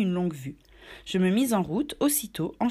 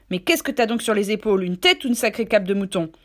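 louder gruff sailor's jeer; mocking scold; 5 dB louder than usual. Silver mocking Morgan's stupidity, loud and gruff. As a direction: jeer at his thick head.

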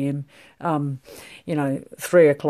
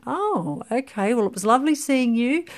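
higher, bright and politely puzzled farewell; awkward polite goodbye; 8 semitones higher than usual. Retold or imitated. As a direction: gently imitate someone left hanging, covering the awkwardness. Confused listener politely wrapping up.

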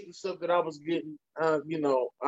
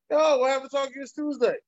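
demanding fan; 8 semitones higher than usual. Retold or imitated. Fans' messages voiced.

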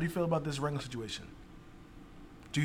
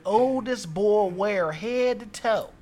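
slow, higher, loud Southern drawl; Southern accent; 4 semitones higher, 7 dB louder, slower than usual. Acted. Reading an angry customer's tweet to the others, in a Southern accent. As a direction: read it out in a drawn-out Southern accent. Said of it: "Can you do a Southern accent?".

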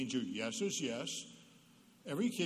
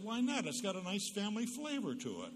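innocent reply; 7 semitones higher than usual. Retold or imitated. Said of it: "I said".

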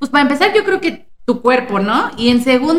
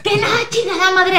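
high, mock-furious snap; mock angry outburst; 7 semitones higher than usual. Friend mimics the pregnant woman's annoyed outburst, mock angry. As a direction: fake rage at nagging friends. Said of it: "así se enojaba".

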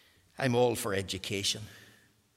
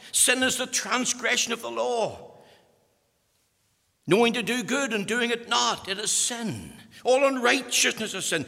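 loud, high, hammering sermon voice; fiery preaching; 10 semitones higher, 7 dB louder than usual. Real, not acted. Preacher declaring what sin is, loud and higher. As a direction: thunder each line at the hall.

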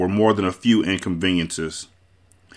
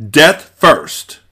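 loud, high defiant roar; 8 semitones higher, 9 dB louder than usual. A narrator's reading. Wesley refuses the prince's demand to surrender, roared. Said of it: "Wesley roared".

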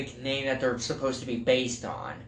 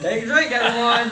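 loud party shout with a laugh; frat bro; 9 semitones higher, 7 dB louder than usual. Acted. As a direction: call out the drinking game rule, whooping. Drinking game call-out.

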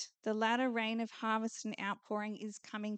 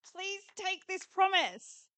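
higher, urgent pleading building louder; pleading Lord; 6 semitones higher, 3 dB louder than usual. Retold or imitated. The Lord urging the people, high pleading. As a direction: beg them to take it, slowing at the end. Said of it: "He's going".

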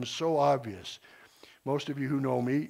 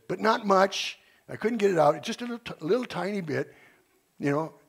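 retelling Mike; 6 semitones higher, 4 dB louder than usual. Retold or imitated. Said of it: "He said".

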